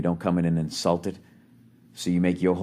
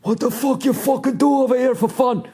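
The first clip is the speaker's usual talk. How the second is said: mock Scottish accent; 13 semitones higher, 3 dB louder than usual. Scottish visitor chatting up Chicago women, hammed-up Scottish accent. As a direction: ham up a rowdy Scottish brogue. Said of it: "Whatever the fuck you're gonna say".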